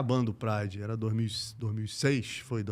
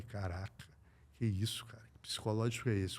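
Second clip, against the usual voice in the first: stunned aside; 4 semitones lower, 8 dB quieter than usual. Retold or imitated.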